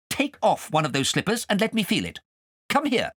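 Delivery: scolding, high and sharp; cross governess. Acted. Governess scolding Kay over pork pie, cross. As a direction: tell him off like a strict governess.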